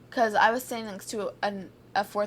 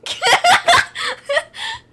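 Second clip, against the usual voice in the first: loud, high-pitched cackle; real high, loud laughter; 11 dB louder than usual. Real, not acted. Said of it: "Why is my laugh so loud?".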